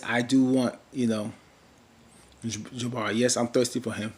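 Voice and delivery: ordinary talk, nothing marked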